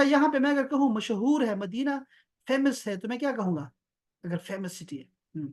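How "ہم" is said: ordinary delivery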